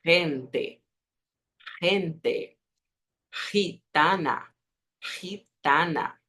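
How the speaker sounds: gente and gitana with a raspy throat J sound; throaty Spanish G. Shown as a demonstration. Teacher showing the throaty G in gente and gitana. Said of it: "arranha un poco la garganta".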